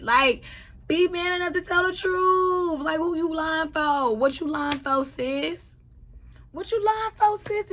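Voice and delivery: high, loud shouting rant; heated rant. Real, not acted. Host shouting at Lisa to admit the truth. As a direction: yell at her to come clean.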